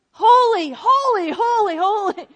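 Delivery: high, loud proclaiming chant; loud call of praise. Acted. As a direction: call out each holy to the room. Calling out praise in worship.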